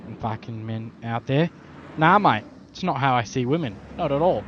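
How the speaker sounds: normal talking voice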